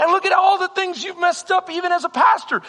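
high, mocking accuser's taunts; accusing enemy voice. The enemy taunts the pastor with his failures, high and accusing. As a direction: needle him with every failure. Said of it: "He says".

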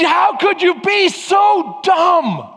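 slow, drawn-out exasperated shout; mock angry scold. Husband yelling at wife over locked keys, hammed-up exasperation. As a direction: stretch out every word in exasperation.

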